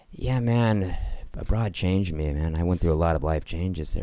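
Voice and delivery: self-important traveler. Retold or imitated. Guy bragging about being abroad, mock-profound and soft. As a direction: sink softly into mock worldly weariness. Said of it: "and he was like".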